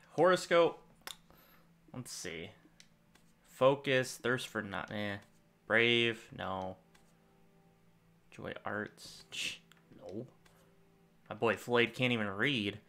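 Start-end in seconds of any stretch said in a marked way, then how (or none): none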